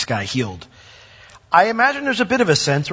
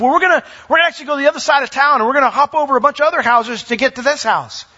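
much higher, fast, tumbling out the scheme; rushed excited plan; 10 semitones higher, 3 dB louder, faster than usual. Retold or imitated. Friends explain the rooftop route to the man, fast and excited. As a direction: rattle off the plan in one breath.

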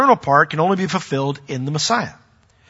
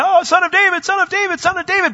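high, loud repeated mock chant of the title; calling out; 5 dB louder than usual. Crowds calling Jesus by the magic title, loud. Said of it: "all these people called out".

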